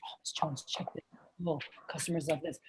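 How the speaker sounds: very low, near-whispered confiding sales line; soft-spoken pitch. Shown as a demonstration. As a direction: demo a soft rep letting the customer in on it. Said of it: "like, softer, like, use your nonverbals".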